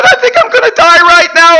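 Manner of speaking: very high, loud hysterical shriek; acted gasping, hooting hysteria; hooting with laughter. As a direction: shout it as if helpless with giggles. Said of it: "we laughed so hard we gasped and wept".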